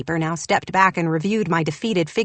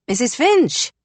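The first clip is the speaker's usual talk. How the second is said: raised shout across the kitchen; 5 semitones higher, slower than usual. A narrator's reading. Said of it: "John shouted again".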